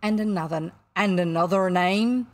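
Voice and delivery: loud captain's probing question; quizzing captain. A narrator's reading. Captain plays guessing game with stranger, probing. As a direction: press the question with booming weight.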